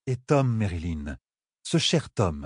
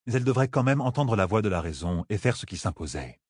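ordinary delivery, nothing marked

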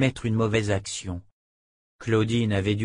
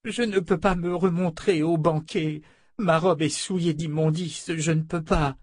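higher, pleading noblewoman voice; distraught noblewoman; 8 semitones higher than usual. A narrator's reading. Claudine pleading with Fitch, a lightly voiced distressed lady. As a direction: beg not to be sent back.

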